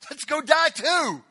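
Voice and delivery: loud, drawn-out rallying cry; rallying shout. Retold or imitated. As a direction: raise the voice, slow and emphatic. Acted quote of disciple's rallying cry.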